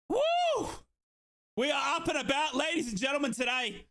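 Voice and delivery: high whoop into a shouted announcement; whoop, then proclaim it to the crowd; excited whoop. Real, not acted. Celebrating lead again.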